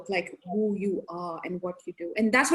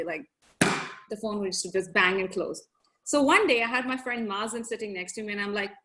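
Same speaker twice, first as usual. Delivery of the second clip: ordinary talk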